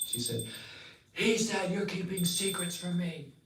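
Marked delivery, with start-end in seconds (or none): 1.1-3.3 higher voice, wounded complaint; hurt accusing wife. Acted. Parvati accusing her husband, higher wounded voice. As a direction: sulk the accusation at him.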